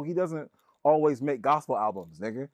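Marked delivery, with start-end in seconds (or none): none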